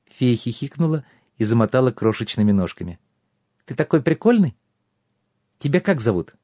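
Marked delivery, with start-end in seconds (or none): 3.7-6.3 pixie. A narrator's reading. Giggly pixie speaks.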